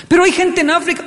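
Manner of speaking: very high insistent plea; mother pressing the child about starving kids; pleading mother. Retold or imitated. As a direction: whine the guilt trip.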